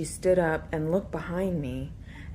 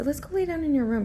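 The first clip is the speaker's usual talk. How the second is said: put-on higher, faster coaxing to a toddler; quick coaxing; 10 semitones higher, 4 dB louder, faster than usual. Babysitter nudging the little girl toward her room, quick and bright. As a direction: hurry her along brightly.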